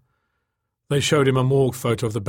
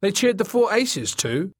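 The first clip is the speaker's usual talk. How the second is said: pitched well up, sharp retort; 9 semitones higher than usual. A narrator's reading. Man answering about who got cheered, sharp. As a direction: make the point sharply in a high pitch. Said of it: "he said sharply".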